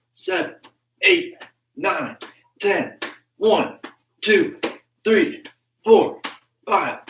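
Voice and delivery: high, punchy count with falling pitch; counting out reps. Real, not acted. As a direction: call each number out separately to the class. Counts jumping jacks for class.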